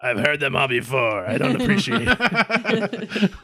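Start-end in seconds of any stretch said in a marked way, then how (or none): 1.3-3.3 mock offended. GM voicing the alien mock offended.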